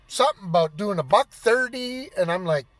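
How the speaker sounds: normal talking voice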